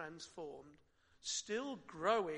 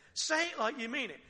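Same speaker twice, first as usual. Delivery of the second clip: louder, higher, a parent's stern demand; exasperated parent; 7 semitones higher, 8 dB louder than usual. Retold or imitated. Parent scolding a child for a hollow apology, louder. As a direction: insist the child means it. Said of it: "This is a ridiculous thing to say".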